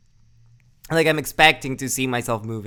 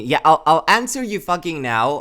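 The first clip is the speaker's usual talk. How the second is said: louder, exasperated Australian-free rant at chat; exasperated raised voice answering chat; 4 semitones higher, 7 dB louder than usual. Real, not acted. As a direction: blurt it out, fed up and loud. Exasperated reply to chat DM nag.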